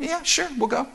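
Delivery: high, soft acted reply; mock easy agreement. Apostles answering officers who asked them along, easy agreement.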